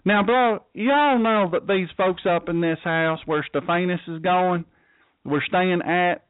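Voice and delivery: put-on Southern accent. Pastor imitating Southern US speakers retelling the verse, Southern accent. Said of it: "If it were the southern part of the United States".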